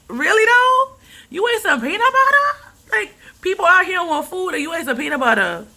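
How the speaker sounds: can't believe they'd waste it; outraged disbelief. Real, not acted. Ranting about wasted peanut butter.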